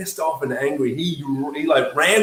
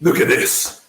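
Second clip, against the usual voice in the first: stern whisper. Retold or imitated. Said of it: "He's like".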